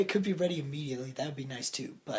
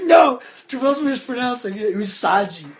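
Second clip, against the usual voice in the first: mock Ricky; 9 semitones higher, 14 dB louder than usual. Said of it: "He's like".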